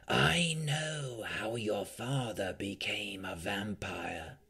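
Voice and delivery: slow, hushed vampire whisper; grave-silent voice. A narrator's reading. Vampire tempting Rowan with secrets in her dream, slow hushed whisper. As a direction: draw it out in an eerie, whispery murmur. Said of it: "in a voice more silent than the grave".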